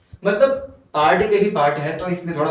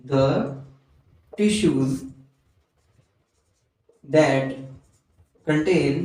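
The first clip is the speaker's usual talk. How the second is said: lower, halting note-taking pace; slow dictation; 3 semitones lower, much slower than usual. Real, not acted. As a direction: dictate word by word, long pauses. Dictating definition for students to write.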